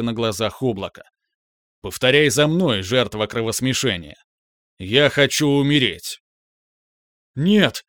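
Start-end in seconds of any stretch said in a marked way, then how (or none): 1.8-6.2 booming arrogant alien overlord. Acted. Cloud commands him to die. 7.3-7.8 loud defiant yell. Acted. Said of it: "заорал я".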